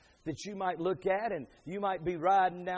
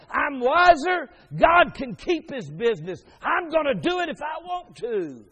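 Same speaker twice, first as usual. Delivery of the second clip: high, loud, impassioned proclamation; full-force preaching; 11 semitones higher, 15 dB louder than usual. Real, not acted. Preacher tells congregation they violated God's laws, full-force preaching. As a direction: cry out against defiance at full volume.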